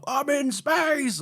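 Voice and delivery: drawn-out, high mock wonder; hammed-up awe at being in space; awestruck companion. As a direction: stretch it slowly like a big dramatic moment. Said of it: "a big moment of".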